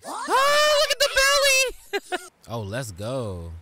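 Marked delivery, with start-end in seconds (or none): 0.1-1.3 high, loud, delighted yell; excited shout. Real, not acted. Excited shout at anime scene. 1.3-2.2 squealing laugh; high delighted laugh. Real, not acted. Laugh after shout.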